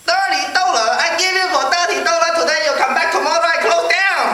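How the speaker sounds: put-on high, outraged Chinese-accented haggler. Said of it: "this Chinese guy came out".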